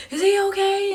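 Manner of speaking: very high, loud worried cry; retold panicked question; tearful alarm. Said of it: "I start crying".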